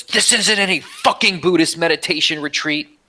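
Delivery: high, mocking angry yell; angry inmate. Retelling the angry inmate in prison, high mock yell. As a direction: spit it out like the furious inmate. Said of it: "he gets so angry".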